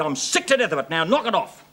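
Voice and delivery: normal talking voice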